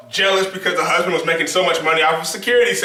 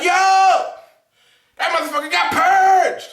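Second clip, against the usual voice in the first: excited; 8 semitones higher, slower than usual. Retold or imitated. Cheering at the screen.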